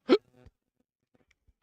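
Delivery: hiccups breaking the words; hiccuping. Real, not acted. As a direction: talk through a hiccup. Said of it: "I'm hiccuping".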